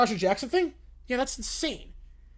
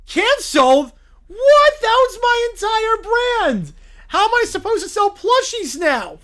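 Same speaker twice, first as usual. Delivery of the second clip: much higher, much louder outraged squeal; outraged shout; 10 semitones higher, 10 dB louder, slower than usual. Acted. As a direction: explode in disbelief. The bear learns his show was canceled.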